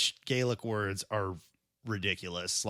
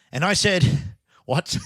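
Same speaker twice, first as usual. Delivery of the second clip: loud storytelling in a mock Irish accent; put-on Irish accent; 5 semitones higher, 6 dB louder than usual. Storyteller baffled by the Irishman's reply, in an attempted Irish accent. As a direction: keep the amateur Irish lilt going. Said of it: "You better do it in an accent".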